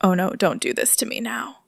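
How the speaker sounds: panicked whisper under the breath; whispered plea to the buzzing phone. Retold or imitated. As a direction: hiss it so the teacher won't hear. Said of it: "I'm like".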